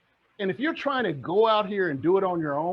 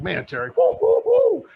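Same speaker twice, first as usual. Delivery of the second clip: mock hype; 14 semitones higher, 7 dB louder than usual. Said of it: "Stacy's like".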